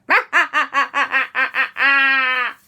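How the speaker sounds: loud staccato villain laugh; evil Martian cackle. Acted. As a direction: laugh like a triumphant space invader. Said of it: "And the Martian went".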